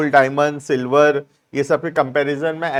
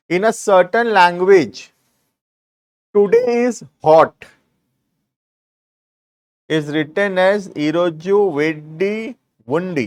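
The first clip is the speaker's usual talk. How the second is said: slow, deliberate dictation to students; teacher dictating word by word; much slower than usual. Shown as a demonstration. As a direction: read each clause out slowly for notes. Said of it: "dictating the example slowly".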